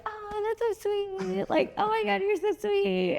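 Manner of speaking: high-pitched fawning reaction; high gushing coo. Retold or imitated. Gushing reaction acted out in quote.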